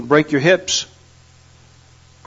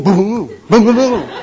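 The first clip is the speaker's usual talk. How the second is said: imitated bass vibration; 3 semitones higher, slower than usual. Walking down the road vibrating from the bass, imitated booming. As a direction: mimic a body vibrating with the bass. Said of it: "you're vibrating from the bass".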